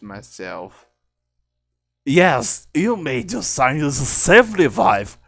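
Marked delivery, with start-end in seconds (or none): 2.0-5.2 loud, higher cartoon reply; character voice. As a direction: answer back loudly in character. Character answering the speaker.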